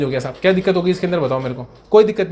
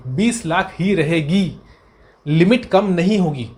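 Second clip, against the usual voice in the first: slow emphasis; slower than usual. Real, not acted. Teacher stresses the key answer.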